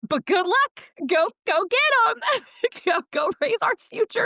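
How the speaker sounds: very high, loud mock pep talk; over-the-top sing-out send-off, laughing midway; mock cheering. Critics sending off a maxed-out parent, mock cheering.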